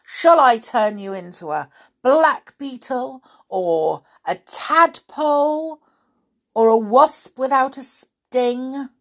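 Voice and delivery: loud, indignant pixie threats; bold. A narrator's reading. Pixie threatening to turn the Snoogle into a beetle, boldly. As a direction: boast boldly and threaten with spells. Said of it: "said Binky boldly".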